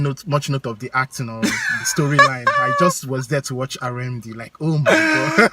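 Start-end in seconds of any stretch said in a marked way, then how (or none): 4.6-5.5 high, breathless gush; swooning. Retold or imitated. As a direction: swoon over a crush. Swooning over the actor.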